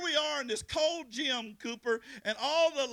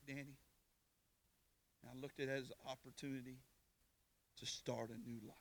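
very low, soft, solemn storytelling; hushed testimony; 9 semitones lower, 10 dB quieter than usual. Real, not acted. Preacher recalls leaving prison for a new life, hushed testimony. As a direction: drop right down, quiet and measured.

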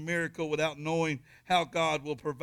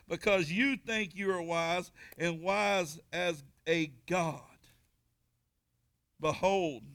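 raised, measured scripture reading; 4 semitones higher, 3 dB louder than usual. Acted. Preacher reading scripture aloud to the congregation. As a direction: read the word to the congregation.

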